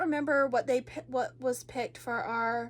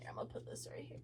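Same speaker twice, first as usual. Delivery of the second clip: whispered to herself; 18 dB quieter, much faster than usual. Real, not acted. Shelving the book, whispering to herself. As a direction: breathe it under your breath.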